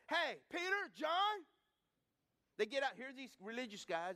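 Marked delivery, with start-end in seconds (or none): 0.1-1.4 calling out. Retold or imitated. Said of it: "They go".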